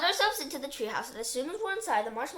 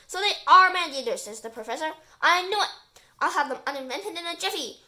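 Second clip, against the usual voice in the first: triumphant; 4 semitones higher, 9 dB louder than usual. Acted. Professor triumphant.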